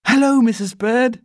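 put-on loud, high girlish greeting; cheery girl. A narrator's reading. Girl greeting the housekeeper, cheery. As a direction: call out hello in a high child's voice.

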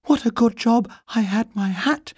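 high, out-of-breath bear voice; panting. A narrator's reading. Paddington just pulled from the bath, panting. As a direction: puff it out after a fright. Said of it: "he panted".

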